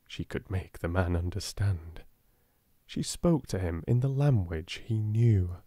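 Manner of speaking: usual speaking voice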